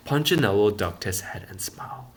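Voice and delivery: ordinary delivery, nothing marked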